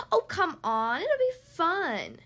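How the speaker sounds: high, drawn-out wheedle; eager duck pleading; quacked coaxing. A narrator's reading. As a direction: coax loudly, stretching it out. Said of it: "quacked Duck".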